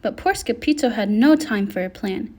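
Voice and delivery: ordinary delivery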